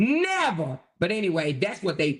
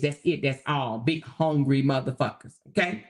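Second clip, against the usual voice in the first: normal talking voice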